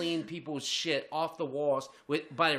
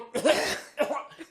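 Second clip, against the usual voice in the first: gagging cough; 10 semitones higher, 4 dB louder than usual. Real, not acted. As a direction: cough and gag through a laugh. Gagging while telling story.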